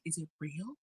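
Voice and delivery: hushed mock wonder. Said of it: "every time he's just like".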